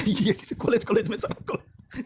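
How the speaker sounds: talking through a laugh; can't hold the laugh in while telling it. Real, not acted. Teacher laughs telling students how famous his joke became, laughing through words.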